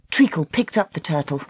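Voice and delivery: usual speaking voice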